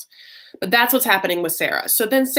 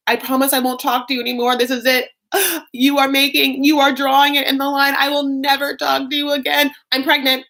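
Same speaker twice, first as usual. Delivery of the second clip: high, loud, hammed-up ultimatum; mock tirade; 7 semitones higher, 5 dB louder than usual. Wife cutting Michael off by phone, mock tirade. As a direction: build into a breathless-with-outrage mock rant. Imitates wife's tirade and revelation.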